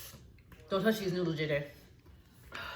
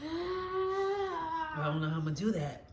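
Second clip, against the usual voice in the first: whiny cry; 8 semitones higher, 7 dB quieter, slower than usual. Real, not acted. Struggling with hot noodle challenge.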